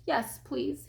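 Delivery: soft, sad little acceptance; sniffling. A narrator's reading. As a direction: accept quietly through sniffles. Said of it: "he sniffed".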